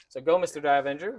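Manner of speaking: ordinary delivery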